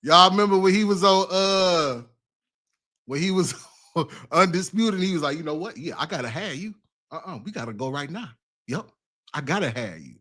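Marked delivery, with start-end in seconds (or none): 5.4-10.2 soft, lower-pitched smooth talk impression; hushed mock sweet-talk; Shannon. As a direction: imitate his talk, quiet and low. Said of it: "that's that Shannon talk, man".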